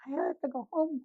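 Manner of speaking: small high plea; mock overwhelmed. Therapist after heavy sessions, mock pleading to leave. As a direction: lift it high and soft, wanting out.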